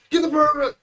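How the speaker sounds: loud, high mock shout; angry yell. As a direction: bark it like a gamer who's interrupted. Gamers yelling at passers-by.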